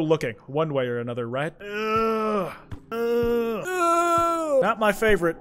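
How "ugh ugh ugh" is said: rising, higher groans of disgust. Real, not acted. Streamer disgusted by gross game visuals, groaning.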